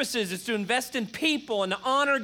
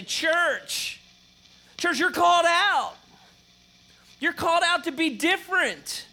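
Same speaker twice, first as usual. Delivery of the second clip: rising, louder call to the congregation; preaching address to the church; 8 semitones higher, 4 dB louder than usual. Shown as a demonstration. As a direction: call out to them like a preacher from the pulpit. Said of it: "I say".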